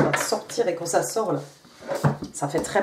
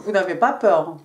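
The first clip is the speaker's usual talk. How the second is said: ordinary delivery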